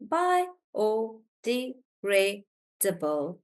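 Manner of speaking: drawn out very slowly, syllable by syllable; slow syllable demo. Shown as a demonstration. Teacher slowly sounding out a long word for students. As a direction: stretch it out so the class hears each part. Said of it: "so bio, Tanya, bio".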